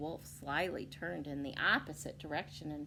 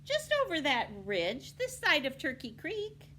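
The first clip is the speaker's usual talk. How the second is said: put-on high, bright little girl; a friendly girl giving directions; cheerful girl; 9 semitones higher, 5 dB louder than usual.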